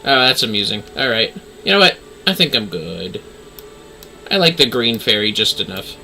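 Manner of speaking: ordinary delivery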